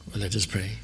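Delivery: ordinary delivery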